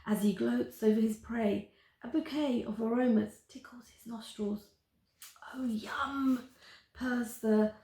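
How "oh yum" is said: soft whispered purr of delight; monster purr. Acted. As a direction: purr it, savouring the smell. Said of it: "purrs the monster".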